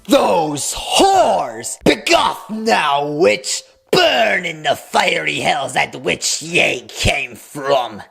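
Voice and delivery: hammed-up fire-and-brimstone condemnation, slow; mock puritan preacher. Mocking the preacher as a witch-hunter, hammed-up slow condemnation. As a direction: play an old witch-hunter damning sinners.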